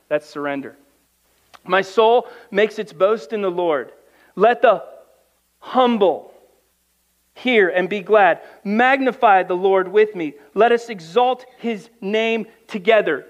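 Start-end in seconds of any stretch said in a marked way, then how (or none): 1.6-13.3 raised, louder psalm reading to the church; preaching scripture. Acted. Preacher reading a psalm to his congregation, raised and louder. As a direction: proclaim the verses to the congregation.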